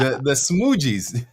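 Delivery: put-on slow, drawn-out country accent. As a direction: drawl out the band name in a country accent. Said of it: "that's my country accent".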